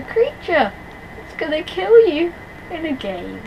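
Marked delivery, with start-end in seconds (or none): none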